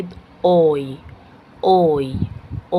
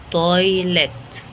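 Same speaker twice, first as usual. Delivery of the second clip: "تويلت" very slow, stretched by syllable; sounded out; much slower than usual. Shown as a demonstration. Teacher introducing "تويلت" to learners, sounded out very slowly.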